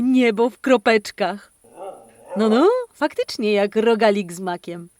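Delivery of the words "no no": ordinary delivery, nothing marked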